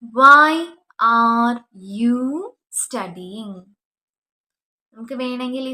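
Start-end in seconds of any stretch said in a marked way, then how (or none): 0.0-3.6 drawn out very slowly, loud start; slow model sentence. Shown as a demonstration. Teacher modelling the question for students, very slow. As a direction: stretch every word for the class. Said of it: "അതെങ്ങനെ ചോദിക്കും?".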